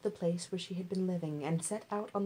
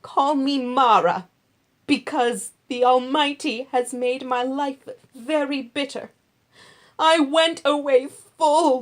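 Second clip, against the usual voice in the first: bitter widow; 6 semitones higher, 12 dB louder than usual. Acted. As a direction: cry out the bitterness of her loss. Naomi's bitter return.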